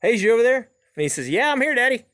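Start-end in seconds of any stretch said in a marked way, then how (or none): 0.0-0.7 higher, louder call across the pond; much higher, louder mock call to the tree. Calling out to hidden boy. 1.3-2.1 put-on high, louder kid's answer; much higher childlike reply to his dad; little boy voice. Small boy answering from bag.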